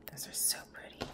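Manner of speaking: breathy hushed whisper of delight. Real, not acted. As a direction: whisper it in awe. Admiring new holo nail supplies.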